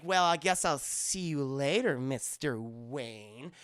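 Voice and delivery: lingering goodbye, slowing and dropping low; mock flirtatious. Barbara bidding Bruce goodbye, hammed-up flirtatious. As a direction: let the name sink low and stretch out.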